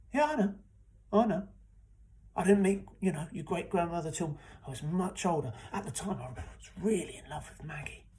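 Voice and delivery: loud, high, flustered insisting; defensive protest. Acted. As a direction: brush off the objection loudly.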